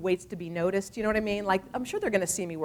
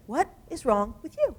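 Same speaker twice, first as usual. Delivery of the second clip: higher, disbelieving "What is wrong with you?"; incredulous repeat; 6 semitones higher than usual. Retold or imitated. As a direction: press it again in amazement, the end rising. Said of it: "He said".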